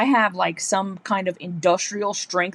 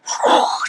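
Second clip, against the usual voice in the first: breathy rush of air; whooshing blow dryer. Retold or imitated. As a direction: imitate a sudden gust from the dryer. Imitates the dryer's gale.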